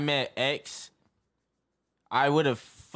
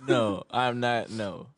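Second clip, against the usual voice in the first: ordinary delivery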